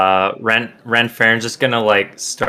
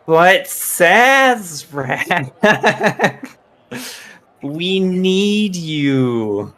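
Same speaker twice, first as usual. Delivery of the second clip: whiny plea; 8 semitones higher, much slower than usual. Acted. Character keeps whining, slow.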